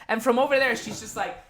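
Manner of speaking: normal talking voice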